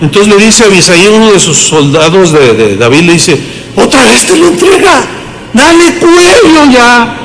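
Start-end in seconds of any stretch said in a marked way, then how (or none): 3.8-7.2 acted high-pitched prodding; eager urging. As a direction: urge him on as an impatient soldier. Acts out soldier urging a killing.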